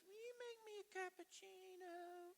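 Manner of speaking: put-on high little-girl call; little daughter's voice. Youngest daughter calling dad from her bed, high girlish voice. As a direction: call up sweetly like a small girl. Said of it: "she goes".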